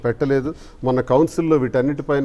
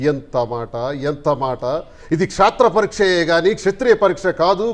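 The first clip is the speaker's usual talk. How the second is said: thundering, indignant mythological-epic oration; Ramarao; 4 semitones higher, 3 dB louder than usual. Acted. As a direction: declaim it grandly, louder and higher, challenging the accuser. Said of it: "Ramarao గారి ఒక dialogue చెప్తాను". (రామారావు గారి పౌరాణిక గంభీర dialogue శైలి)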